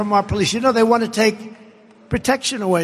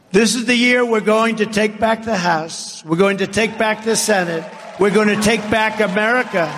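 raised, rousing campaign cry; rally speech; 4 semitones higher than usual. Real, not acted. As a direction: build it up to a cheering crowd. Rally climax, rising pitch.

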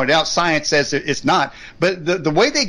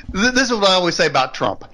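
ordinary talk, nothing marked

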